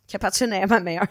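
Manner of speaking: French with an English accent. Real, not acted. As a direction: say it like an English speaker. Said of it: "Je sais pas pourquoi j'ai un accent anglais".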